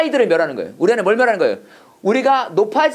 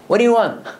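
quick, irritated mock demand; acted anger; 3 semitones higher, much slower than usual. Shown as a demonstration. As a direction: act annoyed, throw it out as a challenge. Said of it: "화내면서 얘기했을 것 같아".

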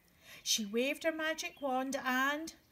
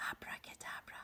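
whispered magic word; 14 dB quieter, faster than usual. Shown as a demonstration. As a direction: whisper it like a secret. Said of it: "I'll show you them again".

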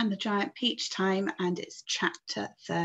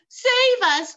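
high-pitched loud plea; crying out; 10 dB louder than usual. A narrator's reading. Miss Spider as the peach falls, crying out. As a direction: cry out to be saved, high and loud. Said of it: "cried Miss Spider".

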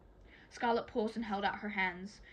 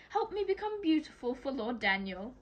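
brightly; 4 semitones higher, 5 dB louder than usual. A narrator's reading. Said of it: "Teller said brightly".

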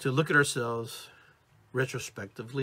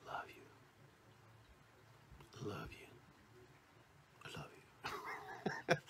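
very soft, low, intimate love you; romantic; 5 semitones lower, 18 dB quieter, faster than usual. Shown as a demonstration. Speaker shows romantic love, intimate hush. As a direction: murmur it like a sweetheart. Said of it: "then you get a romantic love".

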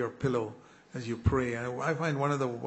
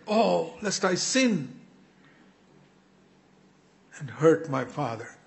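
Jesus praying in fear; 5 semitones higher, 5 dB louder than usual. Retold or imitated. Voicing Jesus's fearful prayer.

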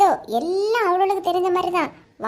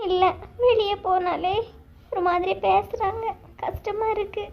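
tearful; 3 semitones higher than usual. Acted. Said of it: "எதுக்கு அழுதுட்டு இருக்கா".

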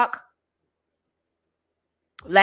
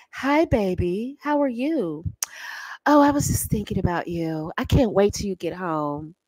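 purring; 3 semitones lower, 5 dB quieter than usual. Shown as a demonstration. Married woman purring to her husband on the phone. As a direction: purr it like you've missed him. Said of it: "You have to purr like a".

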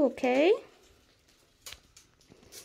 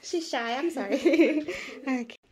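ordinary talk

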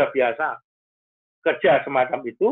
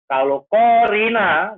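KORINA drawn out on a higher pitch; stressed name; 7 semitones higher, 5 dB louder, slower than usual. Shown as a demonstration. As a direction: stretch KORINA and lift it, slow and loud. Wordplay contrasting Korina with Corona.

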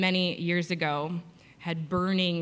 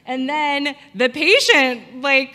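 ordinary talk, nothing marked

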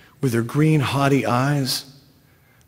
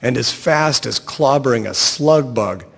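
ordinary delivery

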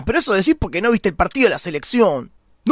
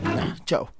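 whispered exit; 9 dB quieter than usual. Acted. Sneaking away from the table.

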